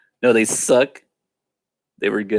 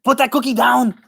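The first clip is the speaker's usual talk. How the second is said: high, strained Austrian action-hero voice; Arnold Schwarzenegger; 10 semitones higher than usual. Acted. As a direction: order it like an Austrian action star, pitched up. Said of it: "doing Arnold voice".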